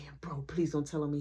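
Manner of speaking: urgent hushed whisper; whispered plea. Retold or imitated. Brother begs Marky in a whisper not to tell. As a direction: beg him under your breath.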